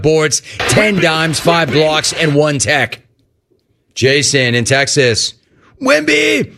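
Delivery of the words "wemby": high, held cheering call; fan's yell. Acted. Host reading Jason in Texas's message, high fan yell.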